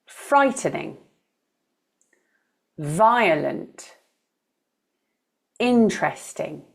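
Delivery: FRIGHTening, VIolent, INteresting, each stressed at the front; frightening, violent, interesting as spaced models with first-syllable stress. Shown as a demonstration. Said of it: "with the first syllable stressed".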